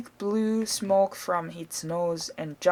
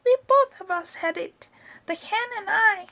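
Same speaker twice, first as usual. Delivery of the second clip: put-on high, cackly old grandma calling down; high-pitched old woman showing off; Grandma's voice; 13 semitones higher, 3 dB louder than usual. A narrator's reading. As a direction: crow it from the rooftop as Grandma. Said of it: "Grandma shouted".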